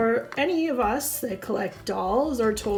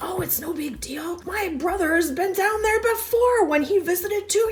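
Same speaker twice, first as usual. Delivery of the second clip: hushed reassurance; 6 semitones higher than usual. Acted. Husband whispers back reassurance.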